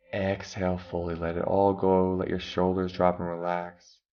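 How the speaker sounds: ordinary talk, nothing marked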